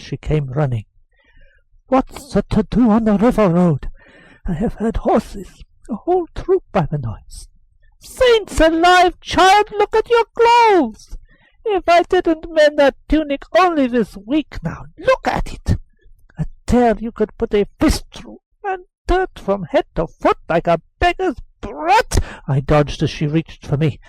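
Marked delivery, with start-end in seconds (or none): 1.9-7.5 put-on boy's voice. Boy asking nurse about horses. 8.0-22.2 high, loud fussing scold; scolding old nurse. Acted. Nurse scolding torn clothes.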